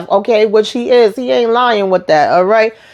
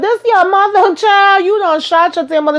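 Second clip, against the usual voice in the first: much higher, laughing through the outrage; shocked disbelief; 11 semitones higher than usual. Retold or imitated. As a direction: squeal it in amused disbelief. Speaker reacting to son shooting mother.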